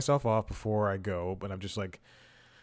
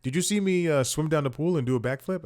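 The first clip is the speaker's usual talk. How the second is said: higher-pitched, lightly imitated Italian accent; a hint of Italian, pitched up; 6 semitones higher, 4 dB louder than usual. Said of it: "I think it might be Italian".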